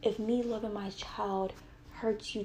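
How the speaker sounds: normal talking voice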